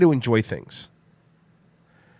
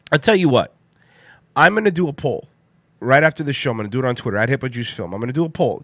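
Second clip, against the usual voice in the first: ordinary talk, nothing marked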